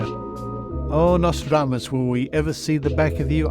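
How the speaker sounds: ordinary talk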